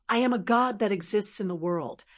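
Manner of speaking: ordinary delivery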